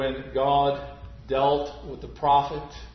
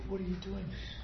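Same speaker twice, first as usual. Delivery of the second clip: soft, hushed, higher voice; a gentle nudge from conscience; whisper of conscience; 5 semitones higher, 12 dB quieter, faster than usual. Retold or imitated. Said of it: "the low whisper of conscience".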